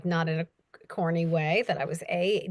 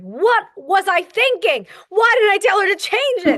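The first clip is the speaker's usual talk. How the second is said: mock panic; 11 semitones higher, 9 dB louder than usual. Narrator panicking over the accent she asked for, in a high mock wail. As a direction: wail it like you've doomed yourself.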